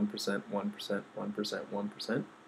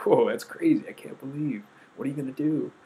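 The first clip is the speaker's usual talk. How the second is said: a loud "whoa", with the rest slightly raised; stunned disbelief; 4 semitones higher, 7 dB louder than usual. Retold or imitated. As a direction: mimic a friend who can't believe it. Friends reacting to shocking news.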